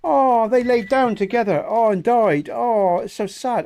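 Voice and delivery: high, slow mock mourning; mock sadness. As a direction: ham up the grief over dead animals. Mock grief over dead animals.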